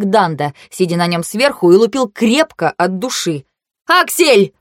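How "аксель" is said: high, urgent name-call; loud call. A narrator's reading. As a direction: call out to stop him. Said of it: "крикнула я громко". (громкий оклик, высоко)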